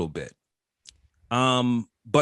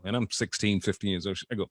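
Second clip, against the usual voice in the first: usual speaking voice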